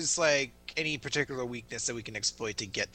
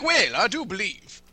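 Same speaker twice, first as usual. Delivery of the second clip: mock impression; 5 semitones higher, 4 dB louder than usual. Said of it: "You're like".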